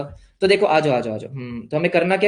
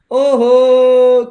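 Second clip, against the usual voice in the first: laughing oho; 5 semitones higher, 10 dB louder, much slower than usual. Real, not acted. Student forgot the debtor entry.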